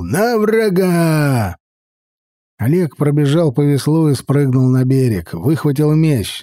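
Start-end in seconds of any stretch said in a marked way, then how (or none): 0.0-1.6 slow, stretched shout upward; roaring battle cry. Acted. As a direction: roar the charge. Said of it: "заревел Лидул".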